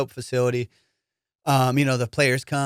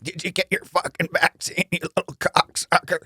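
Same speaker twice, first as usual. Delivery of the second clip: a higher put-on voice of an old politician, crude and teasing; RFK Jr. impression; 5 semitones higher than usual. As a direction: tease the old coach in a mock politician's voice. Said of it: "I'm going like".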